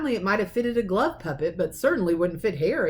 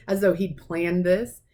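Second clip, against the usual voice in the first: ordinary delivery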